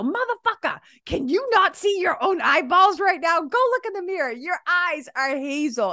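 high-pitched, exasperated friendly rant; playful mock yelling. Telling K T to look in the mirror, playfully yelling. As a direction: re-enact yelling at a friend in fun. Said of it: "I literally start yelling at K T".

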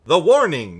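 loud alarmed cry; excited exclamation. Acted. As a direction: call it out in alarm. Excited quote read aloud.

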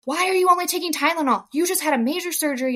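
imitating an upset midwife's scolding; mock angry midwife. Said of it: "got mad at me".

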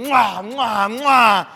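loud, high smacking kisses; big exaggerated cheek kisses; bearded host kissing loudly on both cheeks. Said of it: "just going".

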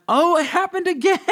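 high, loud retold scream; excited screaming. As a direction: cry out in giddy disbelief. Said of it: "I'm screaming".

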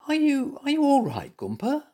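high, hesitant worried question; anxious. A narrator's reading. Zebra checks on muddled bear, anxious high voice. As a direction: ask with anxious concern, pitched high. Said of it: "asked Stripey anxiously".

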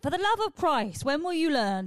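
loud, high exasperated cry; exasperated outcry. Real, not acted. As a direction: throw your hands up and yell it. Exasperated outburst at herself.

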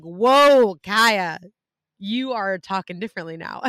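loud drawn-out mock astonishment, then settling; exaggerated parental amazement; retold parents' surprise. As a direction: imitate startled parents, booming the first word. Voices parents startled by her accent.

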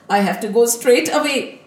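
usual speaking voice

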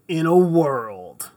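slow, loud movie trailer announcer. Shown as a demonstration. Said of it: "like that kind of style".

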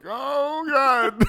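high, loud wailing that breaks into laughter; mock sobbing wail. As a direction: replay the breakdown as a hammed-up cry. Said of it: "I'm just like".

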